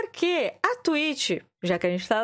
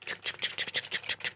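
soft whispered tchuc clicks; whispered typing noise; 8 dB quieter than usual. Retold or imitated. Streamer miming filling in the form, whispered clicks.

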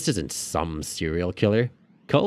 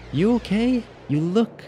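much higher woman's voice; Janet. A narrator's reading. Janet checking on Logan, a woman's worried voice. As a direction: voice her with concern.

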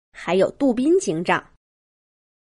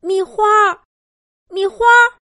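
anxious calling out; 11 semitones higher, 4 dB louder than usual. A narrator's reading. Monkey mother searching for Mihua, calling anxiously. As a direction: call for a lost child, much higher. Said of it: "猴子妈妈焦急地喊着".

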